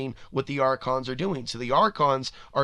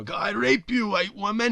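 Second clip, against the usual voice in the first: high, loud mock rant; ranting shout; 9 semitones higher, 5 dB louder than usual. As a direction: bellow it like a raving pundit. Quoting Alex Jones ranting.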